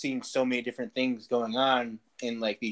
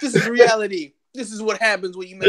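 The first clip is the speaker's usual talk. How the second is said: loud, higher ranting movie line; movie quote shout; 9 semitones higher, 8 dB louder than usual. Retold or imitated. Friend quoting a movie scene, shouting. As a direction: bellow it like the unhinged guy. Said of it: "that Big Lebowski reference".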